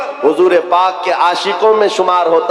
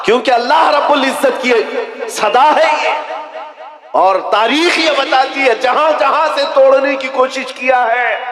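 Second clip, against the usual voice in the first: fiery preaching; 8 semitones higher than usual. Acted. Preaching climax.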